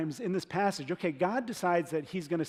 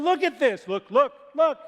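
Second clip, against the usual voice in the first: higher and louder, giddy pointing; excited shouting; 7 semitones higher, 8 dB louder than usual. Retold or imitated. Israelite dragging a hand through the water, shouting excitedly. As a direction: call out like a thrilled kid. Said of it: "just going".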